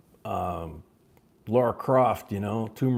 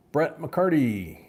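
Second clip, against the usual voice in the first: auctioneer call; 3 semitones higher, 4 dB louder, slower than usual. Acted. Calling the auction bid.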